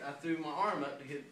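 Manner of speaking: usual speaking voice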